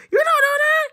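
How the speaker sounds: high-pitched defensive repeat; cartoonish high squeak. Second take, much higher.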